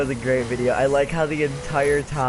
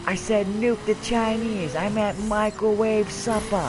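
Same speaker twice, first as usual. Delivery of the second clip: video character quote; 8 semitones higher than usual. Retold or imitated.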